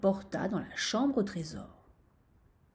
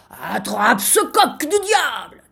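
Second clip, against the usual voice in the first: loud, slow, drawn-out angry order; furious shout; 3 semitones higher, 11 dB louder, slower than usual. A narrator's reading. Sultan sends vizir after rooster, furious shout. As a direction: bellow it with royal fury. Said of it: "cria-t-il".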